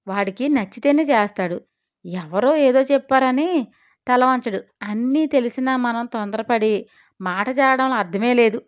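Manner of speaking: usual speaking voice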